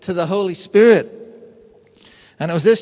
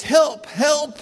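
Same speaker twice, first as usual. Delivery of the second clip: retold yelling for help; 9 semitones higher than usual. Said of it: "screaming and yelling".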